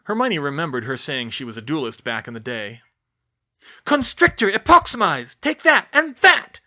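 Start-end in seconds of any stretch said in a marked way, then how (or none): 3.8-6.6 high, loud battle cry; crying out spells. Acted. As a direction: hurl the spells out like a fierce duelist. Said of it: "she cried".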